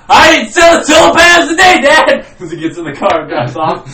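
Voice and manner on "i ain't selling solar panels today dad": high, loud yell while fleeing; mock defiant shout. Salesman yelling to Dad while driving off, mock defiant shout. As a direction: holler it in hammed-up protest.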